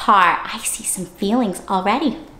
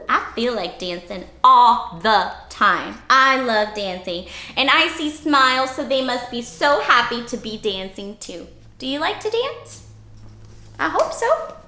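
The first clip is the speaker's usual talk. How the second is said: ordinary talk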